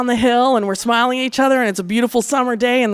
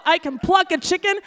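normal talking voice

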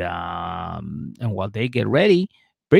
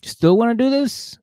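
high, loud, drawn-out officiant voice; Cindy officiating; 7 semitones higher, 6 dB louder, slower than usual. Retold or imitated. Cindy asking the couple at the altar, high and loud. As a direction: ask slowly and loudly, pitched up. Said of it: "And Cindy's like".

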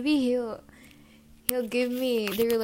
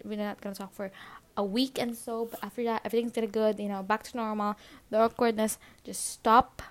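ordinary talk

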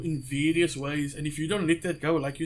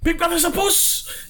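much higher, slow retold yell; mock parting yell; 13 semitones higher than usual. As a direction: holler it like a kid running off down the road. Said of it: "he said".

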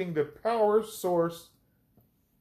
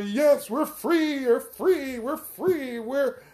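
high-pitched, jubilant crowd cry; shouted victory; 8 semitones higher than usual. Acted. Freed people cheer leaving their enslavers, shouting victory. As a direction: celebrate freedom in a high, ringing chant. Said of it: "shouted victory".

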